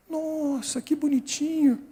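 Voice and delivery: high, soft cooing admiration; admiring nurse. Retold or imitated. Nurse looking at the injured boy, cooing admiration. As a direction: coo over a little child, imitating her. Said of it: "ela olhou e falou assim".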